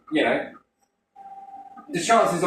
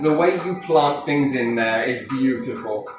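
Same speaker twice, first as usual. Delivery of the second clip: ordinary talk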